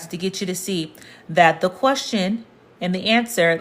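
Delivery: normal talking voice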